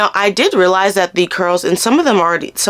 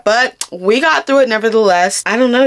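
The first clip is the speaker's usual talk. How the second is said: ordinary delivery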